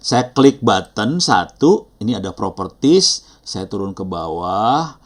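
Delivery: slow, deliberate walkthrough with drawn-out words; slow step-by-step. Shown as a demonstration. Teacher repeating the steps for learners to follow, slowly. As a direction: take each click slowly so it is easy to follow. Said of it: "Saya ulang pelan-pelan ya".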